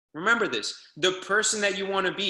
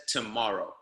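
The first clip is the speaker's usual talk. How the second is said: Rocky's quiet answer; 8 semitones lower, 6 dB quieter than usual. Retold or imitated. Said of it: "He just says".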